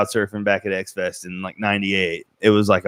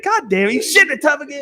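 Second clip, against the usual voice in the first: high-pitched mock scolding; exasperated Megan. Acting out Megan catching him in the tub, high mock scolding. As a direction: imitate a scolding at a raised pitch. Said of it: "Megan said".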